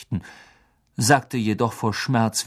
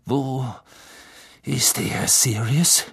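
tense strained voice; 2 semitones higher, slower than usual. A narrator's reading. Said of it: "seine Stimme war zum Zerreißen gespannt".

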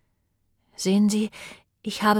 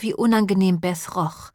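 usual speaking voice

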